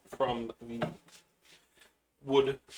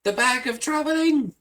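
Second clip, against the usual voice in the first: high, squeaky creature voice full of joy; high excited critter cry; excited little creature; 13 semitones higher, 5 dB louder than usual. Acted. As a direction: chirp it like a thrilled little pet.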